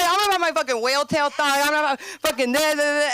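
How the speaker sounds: high, loud mocking swagger; mock showoff. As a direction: ham up the vain showoff.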